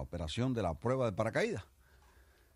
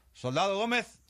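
barked call; 12 semitones higher, 7 dB louder than usual. Acted. Spanish general calls soldier.

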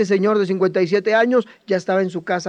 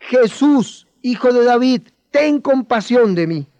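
higher and louder, crying out, slowing at the end; loud plea; 5 semitones higher, 5 dB louder, slower than usual. Shown as a demonstration. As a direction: shout it so Jesus must hear. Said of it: "Dilo más fuerte". (grito de súplica más fuerte)

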